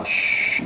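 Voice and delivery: a short unvoiced "嘘", soft, like hushing someone; shushing sound. Shown as a demonstration. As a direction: hush a room with "嘘". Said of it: "就有点像你叫人家不要讲话".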